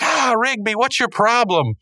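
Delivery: high, drawn-out mock outrage; exasperated boss impression. Boss hit with trash confronting Rigby, exasperated. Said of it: "And he's like".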